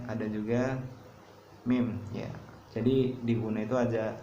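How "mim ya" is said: usual speaking voice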